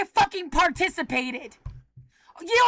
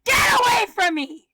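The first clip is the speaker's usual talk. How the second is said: furious yell; 5 semitones higher than usual. Real, not acted. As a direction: drive them off with a raw yell. Screaming at harassers.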